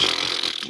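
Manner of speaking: airy raspberry, like air escaping; deflating whoopee cushion noise. Shown as a demonstration. Comic telling of a heart emptying, deflating sound. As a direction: imitate a whoopee cushion going flat.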